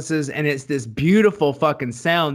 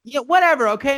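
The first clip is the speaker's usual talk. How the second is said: defensive whatever; 10 semitones higher, 5 dB louder, faster than usual. Real, not acted. Defensive after being corrected.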